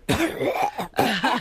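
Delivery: high, hacking mock cough; imitated cough. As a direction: cough it out like the limo driver. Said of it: "like that".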